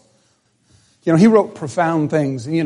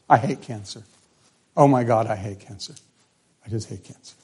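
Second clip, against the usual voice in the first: quiet, lower, near tears; grieving; 7 semitones lower, 8 dB quieter, faster than usual. Real, not acted. Preacher mourning a church member lost to cancer, quiet and broken. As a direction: let the grief drop the voice away. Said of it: "grieving over a church member".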